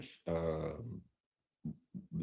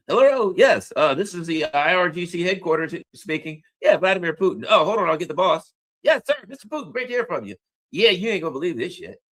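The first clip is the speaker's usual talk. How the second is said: raised-pitch skit voices on a phone; acted phone call; 4 semitones higher, 5 dB louder than usual. IRGC headquarters takes a call from Putin, acted phone voice. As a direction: answer the phone briskly, then greet the caller. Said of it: "He's, like, on the phone".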